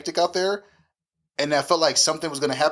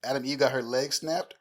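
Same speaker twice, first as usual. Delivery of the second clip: ordinary talk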